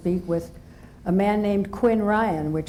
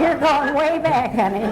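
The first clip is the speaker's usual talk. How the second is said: teasing exclaim; 8 semitones higher, 5 dB louder than usual. Real, not acted. Teasing the interviewer about age.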